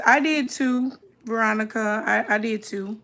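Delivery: normal talking voice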